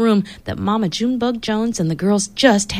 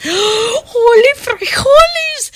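high, slow shocked shout; shocked cry; much slower than usual. Acted. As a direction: cry out in alarm. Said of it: "cried Skipito".

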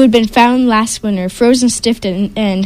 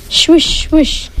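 breathy swish of skis, high; whooshing ski sound; ski swoosh; 5 semitones higher than usual. A narrator's reading. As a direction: hiss it like skis gliding.

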